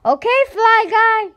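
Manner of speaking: put-on very high, loud kid's yell. Boy giving up the game, loud high yell. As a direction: holler it across the yard. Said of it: "yelled Buzz".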